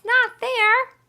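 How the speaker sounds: loud, high cry of alarm; shout. A narrator's reading. Alien shouts as his tricycle crashes, alarmed cry. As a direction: yelp "No" as he crashes. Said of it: "he shouts".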